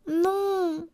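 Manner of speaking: high, drawn-out refusal; high storybook galette voice; little galette. A narrator's reading. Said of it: "dit la galette".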